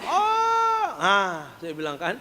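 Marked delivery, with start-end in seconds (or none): 0.0-0.9 imitated long high scream; teriak. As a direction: let out a drawn-out high yell, imitating the possessed. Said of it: "dia teriak". (teriakan panjang melengking, menirukan)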